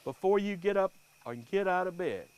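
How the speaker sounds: plain reading voice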